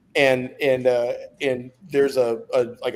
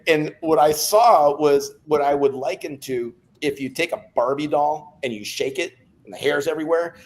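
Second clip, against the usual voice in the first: ordinary talk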